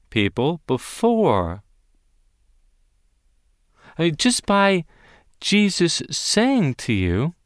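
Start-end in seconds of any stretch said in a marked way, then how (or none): none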